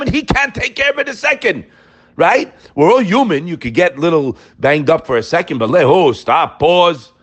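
usual speaking voice

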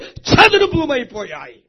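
loud, slow, drawn-out proclamation; thundering climax. Real, not acted. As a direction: thunder it out to the congregation. Climax, then repeated quietly.

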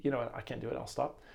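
usual speaking voice

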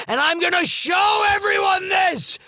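very high mock shouting, held on one pitch; hammed-up showing off. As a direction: proclaim it like a desperate ham actor. Mocks own showy acting onstage.

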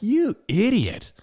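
high and slow, drawn-out scornful jab; exasperated insult. Retold or imitated. Telling about a racing pickup driver, high slow scornful retort. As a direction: stretch it out like you can't believe this guy. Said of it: "I'm like".

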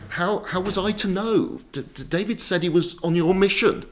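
high, stammering self-defence; flustered pleading. Acted. Dad tries to answer the ranting king, flustered pleading. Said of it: "Dad tries".

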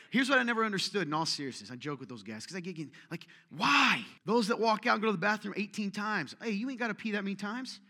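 usual speaking voice

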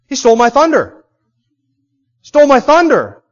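loud, high mock indignation, building on the repeat; indignant outburst. Doctor Luke complaining about Paul's healing, mock indignant and loud. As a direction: play Luke sulking that Paul stole his thunder. Luke's jealous inner thought.

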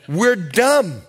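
ordinary talk, nothing marked

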